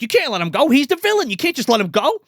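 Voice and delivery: loud, high puppet voice; Fats. Retold or imitated. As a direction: imitate a dummy urgently scolding its owner. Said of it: "And Fats is like".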